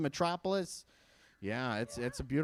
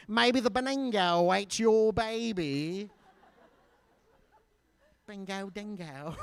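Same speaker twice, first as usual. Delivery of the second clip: higher, drawn-out mock Australian voice; Australian accent; 5 semitones higher, slower than usual. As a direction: put on a slow, exaggerated Australian accent. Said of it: "Like two Australian guys sitting around".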